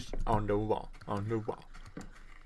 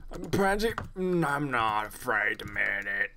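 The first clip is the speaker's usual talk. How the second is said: pansy voice. Pretend. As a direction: play up a wimpy voice, stretching the words. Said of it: "It's like a pansy voice".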